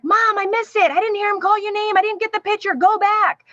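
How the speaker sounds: high-pitched imitation of an excited kid; retold child's urgent, high call to Mom; daughter calling. Daughter urging mom at finish.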